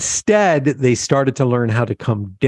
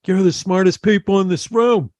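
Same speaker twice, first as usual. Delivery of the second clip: high, emphatic New York voice; flattering New Yorker, pitched up; New York accent; 8 semitones higher than usual. Retold or imitated. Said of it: "he goes".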